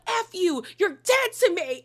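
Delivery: high pitched, hammed-up furious friend; lightly imitating a dramatic blow-up; mock outrage. Recapper voicing Bronwyn cutting Lisa off, high mock fury.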